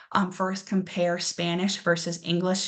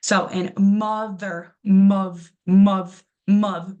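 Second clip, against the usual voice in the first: mov, mov, mov punched much louder, repeated; stressed syllable hammered; 7 dB louder, much slower than usual. Shown as a demonstration. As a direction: hit the first syllable of mother hard each time. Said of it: "that strongest and loudest".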